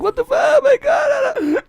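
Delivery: high, loud mock wailing; hammed-up tearful shriek; imitated crying girl. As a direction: wail like a distraught girl, drawing out the end. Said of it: "the poor girl was crying".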